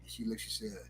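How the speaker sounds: usual speaking voice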